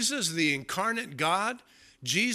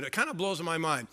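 ordinary talk

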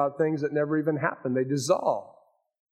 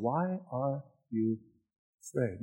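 much softer, slow "Why are you afraid?"; soft intimate question; 7 dB quieter than usual. Acted. Preacher asking the congregation personally, soft and slow. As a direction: drop to a near hush and ask gently. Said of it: "asking you that same question".

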